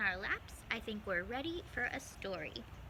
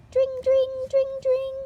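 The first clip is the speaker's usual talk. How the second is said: much higher, louder sing-song ding of a bell; bicycle bell; 15 dB louder, slower than usual. Acted. Mice ringing bicycle bells on the way, sung bell sound. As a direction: ring it out like a little bike bell.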